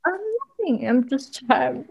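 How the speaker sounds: a touch of teary embarrassment; embarrassed tearful. Retold or imitated. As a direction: play down the tears in a small high voice. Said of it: "And I was like".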